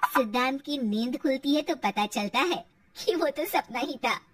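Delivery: ordinary delivery, nothing marked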